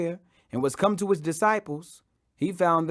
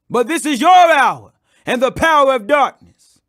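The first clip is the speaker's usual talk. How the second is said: higher, louder, stern confronting voice; Jesus rebuking the armed crowd, stern and raised; stern rebuke; 5 semitones higher, 6 dB louder than usual. Acted. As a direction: face down the mob who came with swords.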